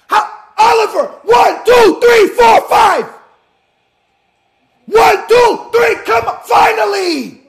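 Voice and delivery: high, loud exasperated calls; urgent shouting. Real, not acted. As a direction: yell the count at the referee. Calling out and counting at match.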